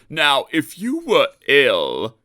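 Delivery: slow, knowing and sly; slow conspiratorial hint; sly wink. A narrator's reading. Gordon slyly hinting to Sir Handel, slow and knowing. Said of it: "he winked".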